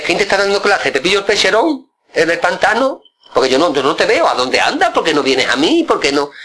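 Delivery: teasing questions in a lifted voice. Retold or imitated. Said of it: "y me decía".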